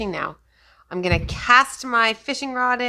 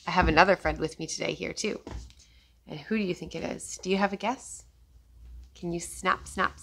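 ordinary talk, nothing marked

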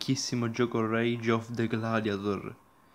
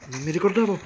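higher and louder, boom burst out; excited; 6 semitones higher, 6 dB louder than usual. Real, not acted. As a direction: react big to the hit. Game action surprises him.

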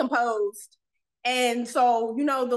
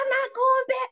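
freaked out; 13 semitones higher than usual. Retold or imitated. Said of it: "freaking the fuck out".

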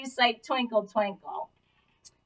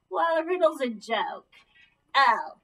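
ordinary talk

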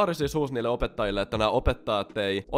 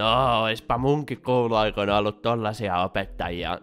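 sleazy flirting; slower than usual. Retold or imitated. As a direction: drag it out like a creepy pickup line. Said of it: "alkoi jotain flirttailee sillä".

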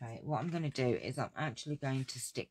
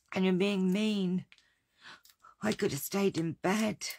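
higher, louder hammed-up self-pity; mock wounded diva; 5 semitones higher, 5 dB louder than usual. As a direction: play the poor hard-done-by martyr for laughs. Pretend-hurt protest to teasing viewers.

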